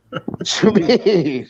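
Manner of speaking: drawn-out, slowed incredulous question; mock disbelief. Real, not acted. Friend drawing out disbelief at the teasing claim. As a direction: stretch it out like you can't believe it.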